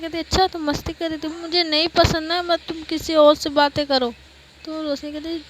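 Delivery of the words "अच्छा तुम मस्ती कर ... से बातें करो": higher, much louder jealous reproach; jealous scolding. Acted. Jealous woman telling her partner off for talking to others, scolding. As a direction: scold a partner with raised pitch and volume.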